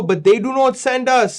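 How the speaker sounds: usual speaking voice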